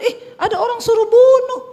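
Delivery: high, loud gleeful demon voice; a devil delighted to hear an invitation; eager devil. Acted.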